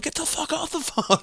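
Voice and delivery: high-pitched yelling sibling. Retold or imitated. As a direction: shriek it at the caller. Sibling voiced in story.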